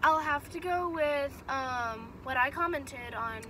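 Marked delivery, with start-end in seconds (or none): none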